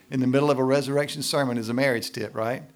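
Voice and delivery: ordinary talk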